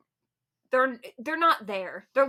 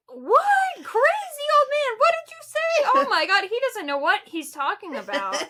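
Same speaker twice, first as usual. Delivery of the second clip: much higher and louder, flustered disbelief; embarrassed outburst; 12 semitones higher, 7 dB louder than usual. Retold or imitated. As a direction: shriek it like a mortified teenager, much higher. Mortified teen reacting to grandpa.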